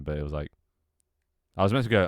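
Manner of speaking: normal talking voice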